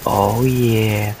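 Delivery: long, drawn-out celebratory cheer; hammed-up savouring glee; acted gloating delight. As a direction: stretch it out like a triumphant kid. Acted joy at finding friends.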